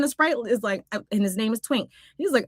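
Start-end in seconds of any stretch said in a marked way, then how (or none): none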